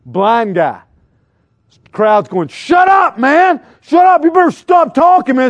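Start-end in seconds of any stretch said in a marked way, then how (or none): 2.5-5.5 high, loud scolding yell; crowd shouting down. Retold or imitated. Crowd ordering the blind man to stop talking, loud scolding. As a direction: bark him into silence.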